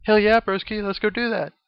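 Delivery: mock pumped-up frat bro; hyped bro. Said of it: "And Rex is like".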